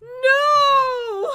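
loud, high wail of disbelief; mock anguish. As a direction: wail it out in playful horror. Told Chuck Norris is gone.

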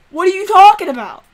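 high and loud; indignant yell. Real, not acted. Streamer yelling at the game in outrage, indignant yell. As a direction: shout it back at the game.

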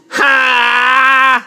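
long, held mock battle "haaa"; drawn-out yell as if charging up energy; power-up yell. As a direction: pretend power-up cry, held steady. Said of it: "I'm powering up".

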